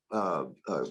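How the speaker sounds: usual speaking voice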